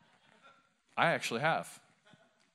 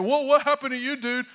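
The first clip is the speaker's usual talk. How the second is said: put-on much higher, louder startled teenager; shocked teen asking a teammate about a scar; teen locker-room voice; 12 semitones higher, 10 dB louder than usual. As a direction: gawk like a high-school teammate.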